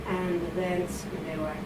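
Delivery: ordinary talk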